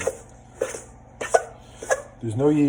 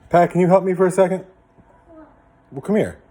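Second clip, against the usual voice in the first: raised voice calling someone over; 6 semitones higher, 10 dB louder than usual. Real, not acted. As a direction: call across the room to Pat. Calling someone to come help.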